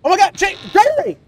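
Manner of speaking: high, loud urgent yells; panicked shouting. Real, not acted. Player yelling to guard during attack, panicked shout. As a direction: scream for the guard to act.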